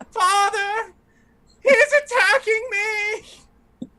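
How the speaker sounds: very high, loud crybaby wail, slow at the end; whiny child crying for daddy. Acted. Spoiled fop wailing to his father about the paladin. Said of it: "he yells back".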